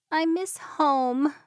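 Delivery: a small, slow, crying voice; tearful. Acted. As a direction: say it through held-back tears. Gabby crying alone in barn.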